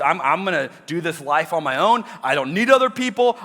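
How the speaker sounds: loud, high mock bravado; defiant boast. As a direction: puff yourself up, insisting you need no one. Mock self-reliant boast.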